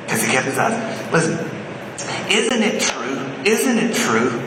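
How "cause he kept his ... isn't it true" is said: ordinary delivery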